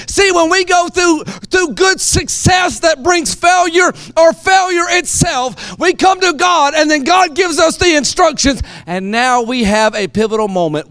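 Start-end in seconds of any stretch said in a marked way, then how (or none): 0.1-8.6 loud, high preaching shout; rising sermon shout over music; preaching at full force. Acted. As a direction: drive the point home, full-throated.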